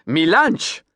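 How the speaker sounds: insistent Creole "Mi lunch!" with lunch said like launch; Creole accent. Acted. Captain insisting on his boat's name, Creole accent. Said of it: "his Creole accent".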